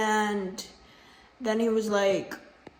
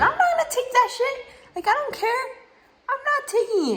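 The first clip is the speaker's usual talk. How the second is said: much higher, laughing through the words; defiant laugh. Real, not acted. Telling viewers she won't take disrespect, laughing high defiance. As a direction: laugh off the insult while refusing it.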